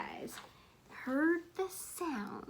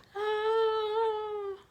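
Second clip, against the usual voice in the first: sharp high gasp; scared team gasp; frightened gasp; 10 semitones higher than usual. Acted. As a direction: gasp in horror.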